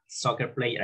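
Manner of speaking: normal talking voice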